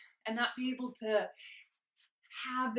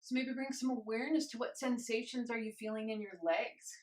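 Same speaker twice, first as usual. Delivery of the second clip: normal talking voice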